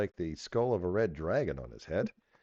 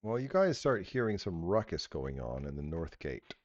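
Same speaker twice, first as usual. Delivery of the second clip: ordinary talk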